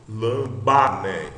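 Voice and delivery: lambané said slowly, "ba" as the strong syllable; stress on BA. Shown as a demonstration. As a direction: stretch out lambané, accenting the middle "ba". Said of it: "a sílaba forte é o ba".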